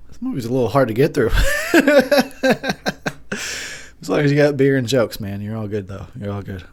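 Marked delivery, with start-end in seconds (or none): 1.3-4.0 laugh. Real, not acted.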